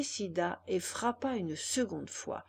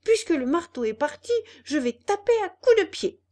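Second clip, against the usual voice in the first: building to a high, loud fast shout; angry puppet about to kick the door; furious puppet; 9 semitones higher, 8 dB louder than usual. Acted. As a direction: rage louder and higher as he goes.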